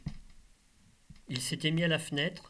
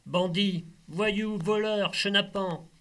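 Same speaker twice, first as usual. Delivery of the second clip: poem recital; 5 semitones higher, 4 dB louder, slower than usual. Acted. Poet declaims his poem.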